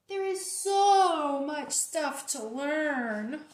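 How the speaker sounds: slow, loud overwhelmed cry; overwhelmed wail. Acted. Pigeon dreading school, slow loud overwhelmed wail. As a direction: stretch it out in despair.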